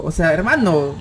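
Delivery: ordinary delivery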